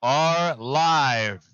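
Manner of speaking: slow, high, loud broadcast opener; live announcer. Acted. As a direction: announce it like going live on air.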